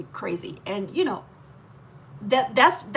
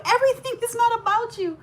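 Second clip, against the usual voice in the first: high accusing repeat, a little less loud; imitating a repeated scolding; 13 semitones higher, 4 dB louder than usual. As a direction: hammer the same accusation again, less loudly. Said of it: "she starts going in on me".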